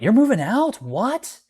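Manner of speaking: high, incredulous "moving out?"; shocked disbelief. Retold or imitated. To roommate, stunned and incredulous. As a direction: echo his news back, stunned. Shocked reaction in retold quarrel.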